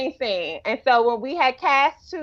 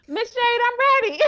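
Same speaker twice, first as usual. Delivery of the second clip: put-on much higher, playful girl's voice; imitated eager teen; 12 semitones higher than usual. Director imagines teen actress returning to her, high mock eagerness. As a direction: pipe up high as a teen announcing herself. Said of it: "old girl might be like".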